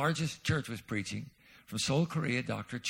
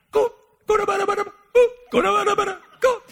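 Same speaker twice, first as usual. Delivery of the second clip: very high, loud golf cheer; mock cheering; 11 dB louder than usual. [name] urging his golf ball along, high excited cheer. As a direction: urge the ball on.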